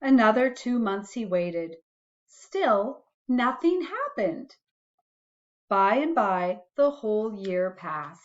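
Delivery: put-on gentle read-aloud for a child; reading a bedtime story to a little one; storybook reading. A narrator's reading. Parent reading a picture book to a child, gentle storytelling.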